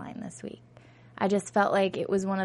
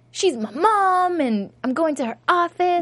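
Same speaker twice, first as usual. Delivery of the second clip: put-on determined teen; 8 semitones higher, 4 dB louder than usual. Voicing Daphne's determination.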